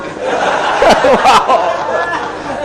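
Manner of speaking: high, airy burst of laughter; hearty laugh. Real, not acted. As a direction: laugh out at the joke. Laughs at group joke. (ngguyu ngakak)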